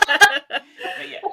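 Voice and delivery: high, loud laughing words. Real, not acted. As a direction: laugh through it. Bursting into laughter.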